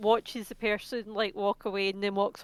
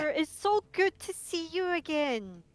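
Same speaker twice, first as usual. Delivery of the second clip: high-pitched put-on greeting; feigned fondness; 8 semitones higher than usual. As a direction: play along as a long-lost friend. Plays along as old friend.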